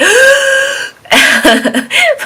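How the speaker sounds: loud, high dramatic gasp; sharp mock gasp of horror at the jump; shocked gasp. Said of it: "you're kinda like".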